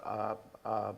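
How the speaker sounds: normal talking voice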